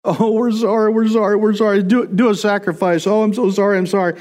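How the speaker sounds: hammed-up penitent pleading; mock apology. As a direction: parody a crowd gushing sorry with no weight behind it. Mocking Israelites' shallow apology.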